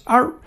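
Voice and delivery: one short high yap; imitated dog bark. Dog still going after five minutes, one imitated bark. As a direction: give a single small-dog yap. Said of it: "it's just no big bark".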